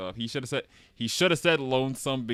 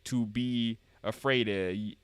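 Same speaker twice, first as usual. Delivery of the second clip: put-on slow Southern old-man drawl; Southern accent. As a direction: lecture a child in drawn-out Southern. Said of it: "somebody uses Southern voice".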